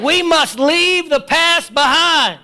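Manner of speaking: loud, high, driving preacher's cry; preaching at full force. Acted. Preacher shouting to the congregation, full force preaching. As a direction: hammer it home to the congregation. Call and response.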